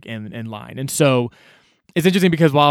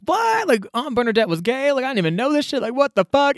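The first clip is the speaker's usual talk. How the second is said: much higher, shocked squeal of disbelief; stunned disbelief; 11 semitones higher than usual. Retold or imitated. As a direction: gasp it out like the news just hit.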